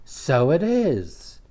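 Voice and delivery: light, high woman's voice; bright surprised party guest. Acted. Party guest admiring the tattoo, high woman's voice.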